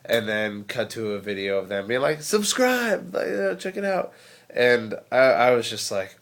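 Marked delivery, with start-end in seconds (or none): 2.2-4.1 high-pitched imitation of an attention seeker; mock YouTuber plug. Mimicking wannabe-famous YouTubers to the camera, mock high plug. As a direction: chirp a mock subscribe plea, much higher.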